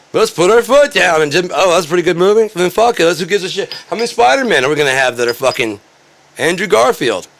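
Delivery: high, loud angry rant; heated rant. Real, not acted. Host ranting at Americans over stolen jobs, furious. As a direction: tear into them, full steam.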